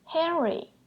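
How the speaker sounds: Henry called out on a high pitch; high-pitched mom calling Henry. Shown as a demonstration. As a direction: call the name high like a mom. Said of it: "that's a high-pitched tone".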